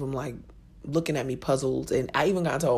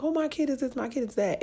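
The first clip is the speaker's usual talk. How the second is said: much higher, sing-song parent brag; mock bragging parent; 11 semitones higher than usual. Mocking parents who boast about their kids in a high sing-song. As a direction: lampoon a proud parent gently.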